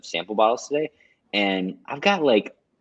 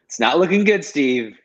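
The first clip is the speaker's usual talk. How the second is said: mock alarm; 7 semitones higher, 6 dB louder than usual. Real, not acted. Teasing friend over mispronounced word.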